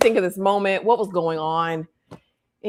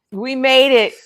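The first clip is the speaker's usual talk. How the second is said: louder, triumphant repeat; excited; 4 semitones higher, 6 dB louder than usual. Shown as a demonstration. Cheering the survivors, louder and excited. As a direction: celebrate it.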